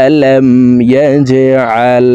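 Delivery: slow tajweed chant in repeated phrases; sustained, melodic teaching recitation; Quran recitation drill. Shown as a demonstration. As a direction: recite with a very long final vowel.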